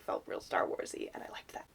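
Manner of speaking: hushed aside; whispering. Real, not acted. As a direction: drop to a whisper. Quiet aside.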